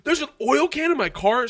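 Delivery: high, loud incredulous question; alarmed disbelief. Retold or imitated. Retelling his shock at the oil can, high alarmed disbelief.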